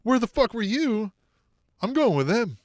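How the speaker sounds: squeaky high brush-off; mock high rejection. Wrestler ditching her partners, high and snippy. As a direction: dismiss them high and snippy. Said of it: "she's like".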